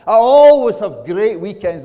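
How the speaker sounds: high, very loud acted boast; gushing enthusiasm. Customer telling bank teller about weekends, hammed-up gushing. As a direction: overdo the delight loudly.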